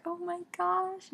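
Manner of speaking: high, drawn-out oh my gosh; slow, stunned fear; alarmed gasp. Retold or imitated. Fearing Tris will die.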